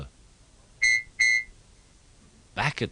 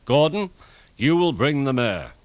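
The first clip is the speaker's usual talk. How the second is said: raised, louder pompous order; important; 5 semitones higher, 5 dB louder than usual. A narrator's reading. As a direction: hand out the job grandly. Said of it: "he said importantly".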